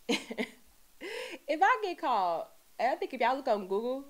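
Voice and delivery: laughing, slow and high. Real, not acted. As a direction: laugh through the words.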